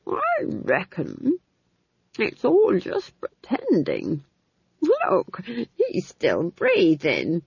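put-on high, drawn-out old-woman musing; said slowly. A narrator's reading. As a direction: reason it out very slowly, word by word. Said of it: "she said slowly".

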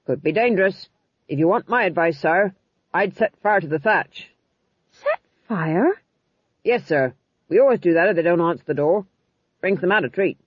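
lightly voiced soldier, matter-of-fact; eager blunt sergeant. A narrator's reading. Sergeant advising the king on opening the door, soldierly. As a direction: give rough advice like an old hand.

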